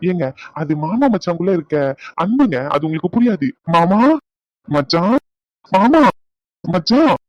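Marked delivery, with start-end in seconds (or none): 3.7-7.2 1 teasing sing-song calling. Acted. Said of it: "கேட்கவே கன்றாவியா இருக்கு".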